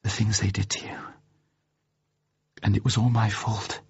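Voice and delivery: hushed, half-whispered guilt; quiet tearful self-blame. A narrator's reading. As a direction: say it very softly, close to tears. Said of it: "Her eyes filled with tears".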